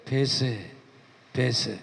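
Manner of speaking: low, soft insistent command; voice of the Lord, quiet. Retold or imitated. The Lord insists his servant speak, low and soft. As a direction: press him gently but firmly, down low.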